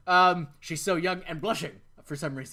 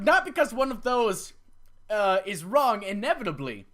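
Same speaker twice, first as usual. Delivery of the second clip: ordinary talk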